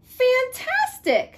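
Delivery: high, slow gushing praise; mock amazement. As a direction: lay the flattery on thick. Elizabeth flatters the dragon.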